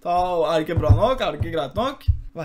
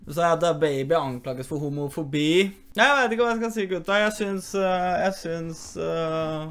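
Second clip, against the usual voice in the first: ordinary talk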